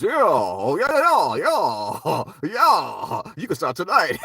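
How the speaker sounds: high, loud drawn-out approving yeahs, laughing through; Bernie Mac impression. Quoting Bernie Mac hiring the dancer, loud laughing yeahs. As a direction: savour each yeah like an impressed boss. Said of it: "And Bernie Mac goes".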